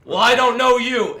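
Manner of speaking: loud retort. Acted. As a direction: bark it back at him loudly. GM voices bandit's retort.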